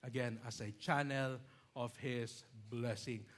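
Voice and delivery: ordinary delivery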